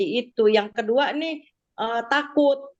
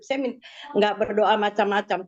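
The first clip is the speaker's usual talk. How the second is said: normal talking voice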